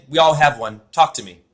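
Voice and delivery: usual speaking voice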